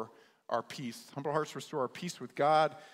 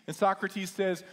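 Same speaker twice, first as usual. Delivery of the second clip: normal talking voice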